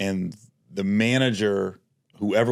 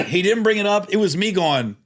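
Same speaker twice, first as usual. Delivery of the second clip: higher, louder disbelief building; indignant retelling; 11 semitones higher, 4 dB louder than usual. Real, not acted. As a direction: hammer home how absurd the detour was. Indignant retelling of coffee detour.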